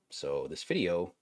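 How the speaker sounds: normal talking voice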